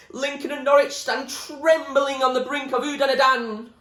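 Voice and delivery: high, loud raving shout; wild yell. Acted. William rants wildly at the top of the choir, shouting. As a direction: rant it like a madman. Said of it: "William stood ranting".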